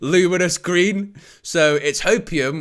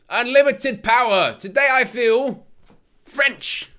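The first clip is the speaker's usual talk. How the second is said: lightly voiced sinister emperor, higher; a hint of a villainous emperor, rising into French; 9 semitones higher, slower than usual. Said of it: "That's how he talks, the Emperor".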